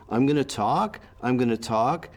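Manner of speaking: each "talk" lifts upward as if asking; Canadian rise. Shown as a demonstration. Linguistic demo of holding the floor, rising tune on "talk". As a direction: keep the turn open, raising the pitch on "talk". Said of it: "it's called the Canadian rise".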